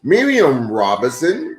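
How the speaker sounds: ordinary delivery, nothing marked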